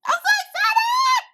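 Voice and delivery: high, slow, drawn-out squeal of joy; real squealing delight; excited squeal. Real, not acted. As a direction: shriek it out, can't contain the thrill. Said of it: "I am so excited".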